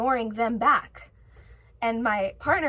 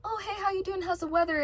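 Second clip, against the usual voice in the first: higher, bright fake small-talk voice; mock small talk; 7 semitones higher, faster than usual. Mocking small talkers she won't imitate, higher chirpy voice. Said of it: "I'm not a small talker".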